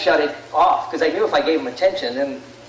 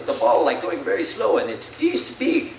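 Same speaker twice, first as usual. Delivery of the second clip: attempted Sammy Sosa accent; 2 semitones higher than usual. Retelling a slugger's interview to the audience, attempted accent. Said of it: "I can't do the accent".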